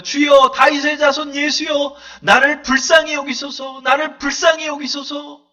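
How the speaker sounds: a blind beggar shouting for mercy; crying out. Acted. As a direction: cry out to Jesus for mercy, slow and high. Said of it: "샤우트라고 그래요".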